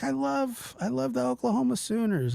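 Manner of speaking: high gushing grandma voice; imitating grandma. Grandma claiming she loves the Sooners, high gushing voice. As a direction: play grandma declaring her love, high. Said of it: "It's like".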